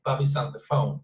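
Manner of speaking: BOBBY'S ON blended together; natural joined-up BOBBY'S ON; connected speech. Shown as a demonstration. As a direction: link the sounds in BOBBY'S ON THE PHONE. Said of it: "So we connect the sounds".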